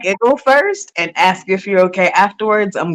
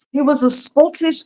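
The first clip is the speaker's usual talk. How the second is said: bad, higher Scottish accent; terrible Scottish accent; 7 semitones higher than usual. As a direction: attempt a clumsy Scottish accent. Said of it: "that was a terrible Scottish accent".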